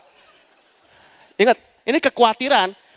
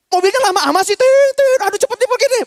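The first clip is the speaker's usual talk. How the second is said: high, loud and exasperated, mimicking the horn with tit tit; impatient honking driver; 12 semitones higher, 4 dB louder than usual. A preacher mimics a rushing churchgoer honking, loud and impatient. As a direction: play a fed-up driver leaning on the horn.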